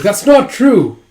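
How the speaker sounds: high, loud retold outburst; acted cry of objection; indignant protest. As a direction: burst out in denial.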